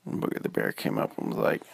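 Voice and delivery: usual speaking voice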